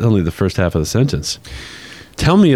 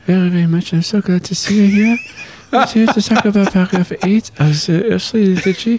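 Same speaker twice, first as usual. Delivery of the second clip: put-on higher German-accented Pope Benedict voice; gentle higher German accent, Pope voice; Pope Benedict impression; 9 semitones higher than usual. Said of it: "Your best accent is your Pope Benedict accent".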